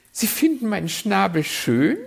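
high, loud, pleased bird voice; flattered and delighted; delighted magpie, high and loud. Acted. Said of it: "freute sich die Elster".

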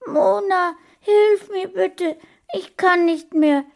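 put-on high, loud child's wailing plea; crying. A narrator's reading. Small boy begging his friend for help, crying. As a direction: plead tearfully in a high voice. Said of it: "weinte Sascha".

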